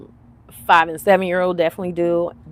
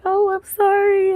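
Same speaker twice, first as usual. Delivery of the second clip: high, drawn-out mock-weepy apology; retold tearful apology; 14 semitones higher, 5 dB louder, slower than usual. Crying mother to her son, retold tearful apology. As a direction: apologise in a stretched, high crybaby whine.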